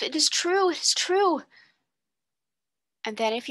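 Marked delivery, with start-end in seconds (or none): 0.0-0.7 higher, rising "true" in breathless surprise; gasped disbelief. A narrator's reading. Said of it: "she gasped". 0.7-1.4 higher voice, "true" falling as it sinks in; gasped conviction. A narrator's reading. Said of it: "she gasped".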